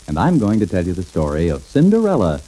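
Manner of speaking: ordinary delivery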